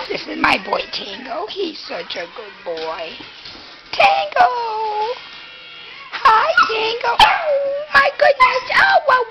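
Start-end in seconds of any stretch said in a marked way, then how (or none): 3.9-9.2 much higher, cooing at the dog; high pet-talk voice. Real, not acted. Owner greeting her dog Tango, high cooing pet voice. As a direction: coo up high like greeting a puppy.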